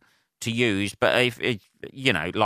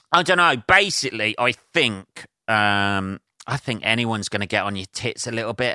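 ordinary talk, nothing marked

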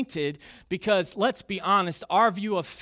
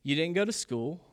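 usual speaking voice

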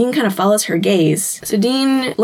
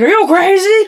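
outraged; 11 semitones higher, 5 dB louder than usual. Acted. Dean objects in heated argument.

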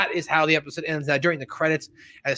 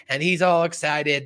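usual speaking voice

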